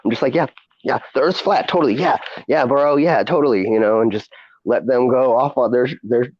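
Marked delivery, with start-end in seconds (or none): 0.3-3.6 higher-pitched, humouring yes-man; fake agreement. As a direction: egg on the conspiracy theorist with mock conviction. Humouring conspiracy theorists, retold.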